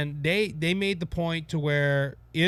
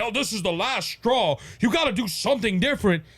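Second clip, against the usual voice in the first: lightly voiced, higher Biden impression; imitating Biden; 4 semitones higher than usual. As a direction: play a fed-up president, pitched up. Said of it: "made President Joe Biden".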